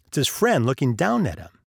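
usual speaking voice

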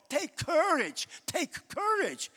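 the pat line, said high and slow; mock reassuring preacher. Preacher mimicking what preachers always say to the church, mock soothing. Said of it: "we always say".

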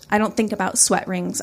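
ordinary delivery

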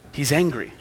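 ordinary talk

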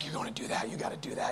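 whispered, low temptation; hushed tempter in your ear; tempting enemy. Acted. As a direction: egg someone on in a secretive whisper. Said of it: "The enemy's like".

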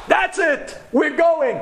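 high, punchy burst of resolve; high-pitched rallying cry; excited decision. Acted. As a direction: declare it like a fired-up teenager. Teen gang's triumphant decision, acted.

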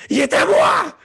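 high, loud alien voice; possessed voice. Acted. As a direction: shriek it in a voice not your own. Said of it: "une voix qui n'est pas la sienne".

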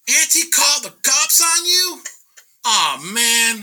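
loud, very high-pitched outraged disbelief; imitated shock, shooting up in pitch; shocked nephew. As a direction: blurt it out high and loud, aghast. Retold nephew's shocked reaction.

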